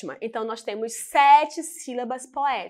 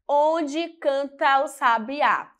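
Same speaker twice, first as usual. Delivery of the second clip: "Onde canta o sabiá" drawn out by syllables; slow syllable demo; slower than usual. Shown as a demonstration. Teacher counting poetic syllables, "Onde canta o sabiá" slowly measured. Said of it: "Vamos lá".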